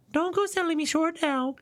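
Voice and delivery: very high put-on voice; witness character voice. Witness protests to the court, high-pitched character voice. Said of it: "Wrong voice".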